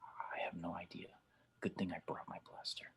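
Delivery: unvoiced whisper to his partner; hushed aside between partners. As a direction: whisper so the stranger won't hear. Aside to partner near stranger.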